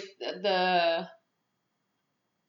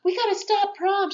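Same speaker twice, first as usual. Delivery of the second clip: high, loud hammed-up warning; frantic mock alarm; 13 semitones higher, 6 dB louder than usual. Girl warning the whole school, frantic mock alarm. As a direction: call it out high, in mock panic.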